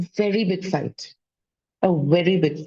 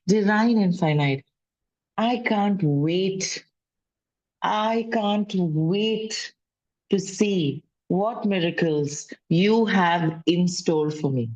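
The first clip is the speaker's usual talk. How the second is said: slow affirmation; slower than usual. Shown as a demonstration. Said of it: "So I say".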